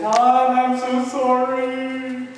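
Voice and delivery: high, drawn-out apology; pleading high voice, stretching the words; apologetic wife. Retold or imitated. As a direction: imitate a wife wailing sorry over the phone. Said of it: "my wife called and said".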